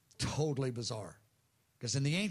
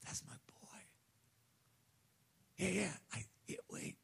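barely voiced whisper, halting; father whispering to himself as he spots his boy; hushed recognition; 3 semitones higher, 9 dB quieter than usual. Father spots son far away.

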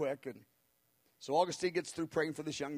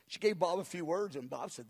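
usual speaking voice

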